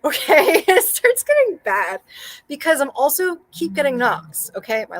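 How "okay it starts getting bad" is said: high, loud laughing confession; real laughter pushing the voice up. Real, not acted. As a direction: say it through an embarrassed laugh. Laughing while confessing weird texts.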